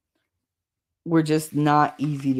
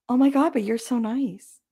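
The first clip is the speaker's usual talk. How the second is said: mock surprise; 8 semitones higher than usual. Imitating surprised strangers.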